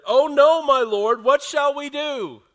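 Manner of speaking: loud, high cry of alarm; panicked servant. Acted. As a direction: cry out in terror at the army. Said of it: "this is the words of the servant".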